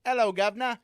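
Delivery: loud, high mock Cockney greeting; over-the-top English accent; British accent. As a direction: ham up a British hello. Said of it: "we should do this whole podcast with accents".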